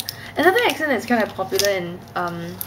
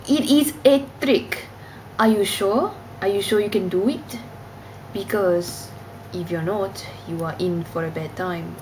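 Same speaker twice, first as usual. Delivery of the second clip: faux European accent. Shown as a demonstration. Annoying friends on a tired night out, faux European accent. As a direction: put on a vague European accent. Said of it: "faux European accent".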